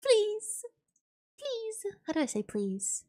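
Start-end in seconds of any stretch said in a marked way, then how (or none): 0.1-1.9 mock pleading. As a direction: beg in a small high voice, playfully. Mock begging after the protest.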